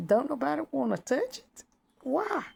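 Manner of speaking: high, squeaky mock voice. Mocking a newly saved woman, in a high squeak. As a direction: play a baffled woman, way up high. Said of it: "It's kinda like".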